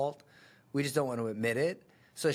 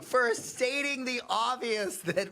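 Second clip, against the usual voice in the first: high exasperated shout; 9 semitones higher, much slower than usual. Real, not acted. Reacting to her admission, a slow high exasperated shout. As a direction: stretch it out in a high, exasperated cry. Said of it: "I'm exhausted after this pitch breakdown".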